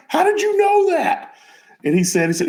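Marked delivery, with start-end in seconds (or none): 0.1-1.3 very high incredulous question; retold amazement. Friend asking his buddy about the hidden cigars, amazed. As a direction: squeal it in mock disbelief.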